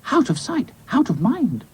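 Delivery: high-pitched story character voice; Dotty, a brisk doll. Acted. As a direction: pipe up as the doll with a plan.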